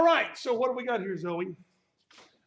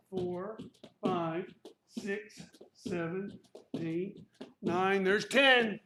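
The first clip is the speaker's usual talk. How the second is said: ordinary talk, nothing marked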